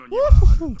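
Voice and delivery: loud, high-pitched whoop; excited whoop. Real, not acted. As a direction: cheer out loud. Cheers at the show.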